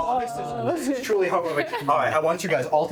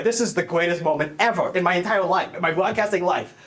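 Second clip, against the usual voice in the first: ordinary delivery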